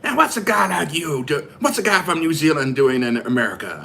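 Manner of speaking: loud, hammed-up American accent. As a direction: play the curious American with a big accent. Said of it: "How's my American accent?".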